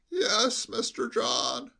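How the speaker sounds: high, slow, quavering sheep-like bleat; an old servant bleating every word; bleating old butler. Acted. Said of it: "bleated like a sheep".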